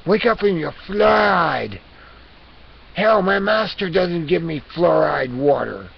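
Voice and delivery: hammed-up outraged cat; indignant rant. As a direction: peak in a shout, then ease down slightly. Cat persona rants at viewers.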